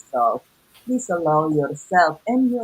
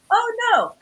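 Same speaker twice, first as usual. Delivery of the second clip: high yelp of alarm, much higher; mock dismay; 4 dB louder than usual. Realizing her taxes will jump, a high alarmed squeak.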